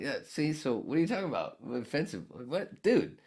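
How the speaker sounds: high, fast, ending in a loud shout; triumphant and incredulous; retold comeback, mock excited yelp; vindicated self. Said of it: "I'm like".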